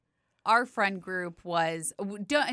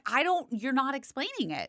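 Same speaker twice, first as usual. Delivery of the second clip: higher-pitched retold objection; flustered protest; 6 semitones higher than usual. Answering yelling ex-husband on the boat, flustered protest. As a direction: push back, voice raised in pitch.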